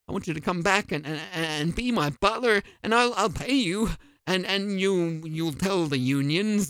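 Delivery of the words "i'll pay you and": ordinary delivery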